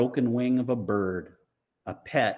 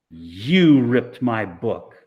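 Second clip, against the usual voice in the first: slow, loud accusation; accusing; 8 dB louder, slower than usual. Acted. Amanda accuses Mars Bar, slow loud accusation. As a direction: lay it on him word by word.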